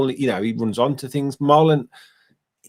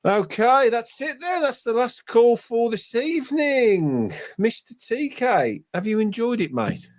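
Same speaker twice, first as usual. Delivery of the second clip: sign-off; 10 semitones higher, slower than usual. Real, not acted. Host wrapping up the show.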